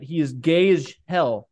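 ordinary delivery

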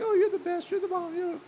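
high, gushing fake praise; patronizing flattery. Flatterer gushing fake praise at a friend, high and gushing. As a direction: lay on the flattery, not meaning a word. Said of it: "someone is just patronizing".

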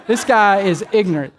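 ordinary talk

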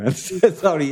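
normal talking voice

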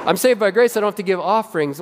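high start, the rest rushed; mock excuse. Pastor mimicking a churchgoer dodging offerings, mock. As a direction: rattle it off like a lazy excuse. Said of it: "voicing congregation's excuse".

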